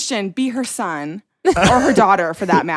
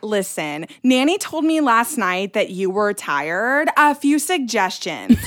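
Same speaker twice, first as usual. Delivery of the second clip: mom's voicemail. Retold or imitated. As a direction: act out mom's measured suggestions. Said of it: "Anyways, I'll repeat it".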